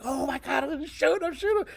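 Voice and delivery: high-pitched mock panic of a kid; imitated freaking out. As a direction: imitate a kid losing it, pitch climbing steeply. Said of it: "the kid is freaking out".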